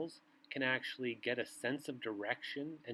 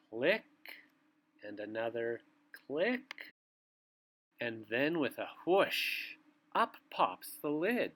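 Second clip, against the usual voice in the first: bedtime story reading; 4 semitones lower, 3 dB louder than usual. A narrator's reading. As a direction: read it like a bedtime book to little ones. Reads bedtime picture book aloud.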